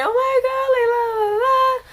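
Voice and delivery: high-pitched hammed-up wail; high fake blubbering; mock crying. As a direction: imitate yourself bawling, voice way up high. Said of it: "And I'm, like, crying".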